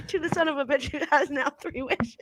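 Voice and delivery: ordinary talk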